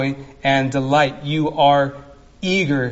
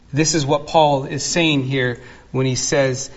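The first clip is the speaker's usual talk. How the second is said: ordinary talk, nothing marked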